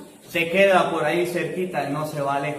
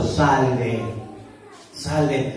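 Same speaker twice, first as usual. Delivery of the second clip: lower, softer, calm order; quiet command; 7 semitones lower, 6 dB quieter than usual. Retold or imitated. Jesus quietly ordering the evil spirit out. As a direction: cast it out with quiet authority.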